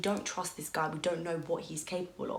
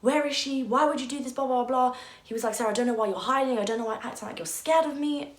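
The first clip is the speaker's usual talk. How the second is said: higher and louder, demanding and accusing; a father barging in, shouting at the shopkeeper, higher and louder; retold shouting; 6 semitones higher, 7 dB louder than usual. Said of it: "my dad walks in shouting into the shop".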